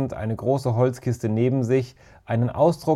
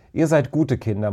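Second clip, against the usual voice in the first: ordinary delivery, nothing marked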